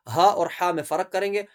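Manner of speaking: normal talking voice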